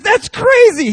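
star-struck student gushing; thrilled squeal. Retold or imitated. Fan overwhelmed.

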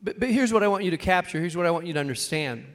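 normal talking voice

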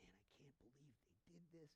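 whispered, crushed and deflated; a hushed, heartbroken Samuel. Retold or imitated. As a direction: breathe it out like a broken man. Samuel heartbroken, voiced quietly.

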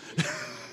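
a sudden high squeal of a laugh; high burst of laughter. Real, not acted. Busts out laughing at son.

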